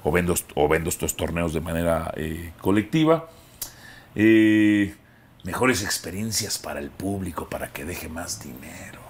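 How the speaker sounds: low, hushed mob-boss murmur; mafioso voice. Acted. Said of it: "¿Por qué la voz de mafioso?".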